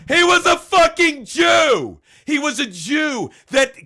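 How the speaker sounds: high, loud enraged yell; real rage shout at the streamer; furious shout. Real, not acted. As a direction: scream it out, pitch way up and loud. Furious rant at Rob Rundo.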